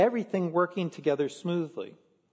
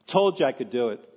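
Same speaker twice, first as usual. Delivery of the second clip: condescending; 4 dB louder than usual. Pretend. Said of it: "Or even more condescending".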